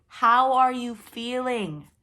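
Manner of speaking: slow, loud, each word spelled out; over-enunciate for a deaf dragon; loud and distinct. Acted. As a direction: Megan repeats to the hard-of-hearing dragon, loud and slow. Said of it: "she repeated more loudly and distinctly".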